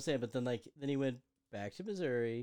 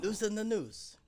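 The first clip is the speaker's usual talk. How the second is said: higher, badly faked Cockney accent; mock Cockney; 6 semitones higher, slower than usual. Co-host pretending to surrender at the gallows, mock Cockney. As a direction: put on a dubious Cockney for the plea. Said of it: "You're cockney".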